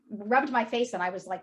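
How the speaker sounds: normal talking voice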